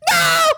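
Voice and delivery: real disbelieving shriek; shrieking laugh and no. Real, not acted. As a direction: laugh bursting into a very high, loud no. Mocking French counting.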